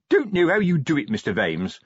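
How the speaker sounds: chirpy sergeant, high at the start; cheerful. A narrator's reading. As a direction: greet the boss cheerfully. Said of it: "said Sergeant Colon cheerfully".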